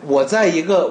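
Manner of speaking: ordinary talk, nothing marked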